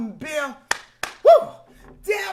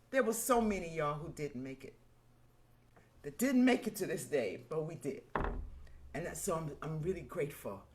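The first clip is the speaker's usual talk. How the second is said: quiet gratitude; 10 semitones lower, 8 dB quieter, faster than usual. Real, not acted. Host thanking viewers after a hard year, quiet. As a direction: lower and much softer, sincere. Remembering those who didn't make it.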